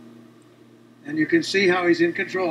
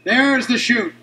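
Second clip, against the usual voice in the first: excited; 5 semitones higher, 4 dB louder than usual. Real, not acted. Commentator sees parachute open.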